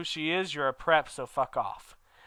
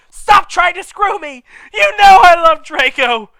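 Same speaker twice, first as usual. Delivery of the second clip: much higher, much louder shout, voice breaking into a cry; 17 dB louder than usual. Acted. Ebony shouting at Vampire, crying. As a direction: scream it through tears. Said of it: "I shouted".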